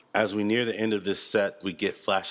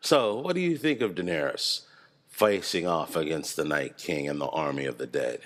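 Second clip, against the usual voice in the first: ordinary talk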